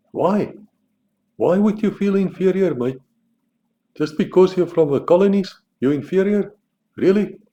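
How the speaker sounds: high, incredulous mock questions; mocking disbelief. Mocking the Australians for feeling inferior, high and incredulous. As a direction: needle them with a mocking high voice.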